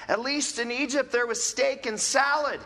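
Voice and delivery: hammed-up ungrateful grumble; grumbling declaration. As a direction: whine about the old days. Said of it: "they declared".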